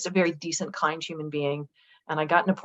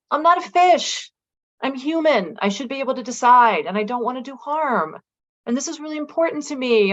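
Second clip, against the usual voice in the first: high, louder upset protest; retold tearful plea; 6 semitones higher, 5 dB louder than usual. To the doctor, refusing meat, tearfully protesting. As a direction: plead through retold tears. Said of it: "I'm, like, crying".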